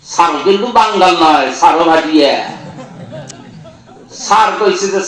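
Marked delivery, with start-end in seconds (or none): none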